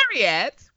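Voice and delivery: "Harriet" repeated as "Arriet"; dropped H in Harriet. Shown as a demonstration. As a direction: show off "Harriet" with no H at the start. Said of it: "see how I did that?".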